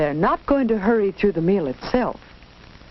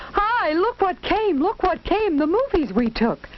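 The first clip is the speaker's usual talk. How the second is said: excited shout; 9 semitones higher than usual. Acted. Boy shows the family the new movies, high and excited. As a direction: announce the news with delight. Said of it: "Tony shouts".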